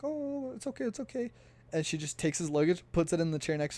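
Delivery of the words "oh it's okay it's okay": much higher, breezy lady's voice; high, light attendant voice brushing it off; flight attendant. Retold or imitated. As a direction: play the unbothered attendant, way up high. Flight attendant's reassuring reply.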